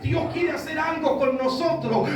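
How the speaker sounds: forceful sermon delivery, building to a shout; preaching. Acted. Preacher warns congregation about the voice of man, forceful preaching. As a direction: preach it out, stretching the key phrases.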